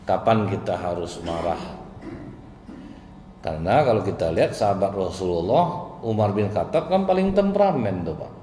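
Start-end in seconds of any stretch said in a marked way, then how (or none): none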